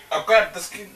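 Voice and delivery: high startled yelps. Real, not acted. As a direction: caught off guard, blurting out. Fumbling blindfolded, startled.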